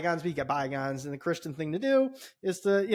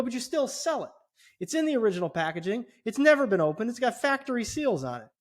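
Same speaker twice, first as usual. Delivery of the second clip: higher, heated protest; retold agitation; 7 semitones higher than usual. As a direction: argue the point, voice up in indignation. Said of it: "now I'm just really agitated".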